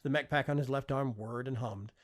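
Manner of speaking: usual speaking voice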